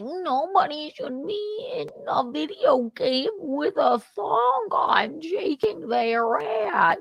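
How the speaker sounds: mocking imitation. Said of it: "He's talking about Melanie being like".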